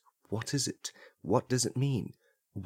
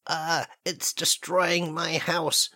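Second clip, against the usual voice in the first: alarmed cry at a giant duck, slow and raised; hammed-up horror, a bit higher; mock panic; 5 semitones higher, slower than usual. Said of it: "they're gonna hear this".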